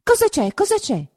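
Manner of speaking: high, hurried alarm; anxious asking. A narrator's reading. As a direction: feign ignorance while holding her back. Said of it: "ripeté Agnese".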